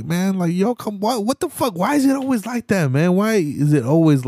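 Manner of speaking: high, squeaky-pitched incredulous protest; exasperated. Real, not acted. Answering a dismissive comment on his story, exasperated. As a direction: protest with the voice shooting way up.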